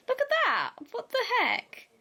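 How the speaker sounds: high delighted exclaim; surprised. Real, not acted. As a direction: squeal with surprise at the fonts. Delighted at fonts.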